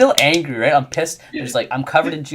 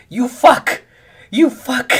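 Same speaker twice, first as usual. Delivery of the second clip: put-on high, loud furious little-kid shout; angry kid yelling; 13 semitones higher, 9 dB louder, much slower than usual. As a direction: yell at the villain on the TV like an outraged kid. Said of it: "she's, like, angry at the character".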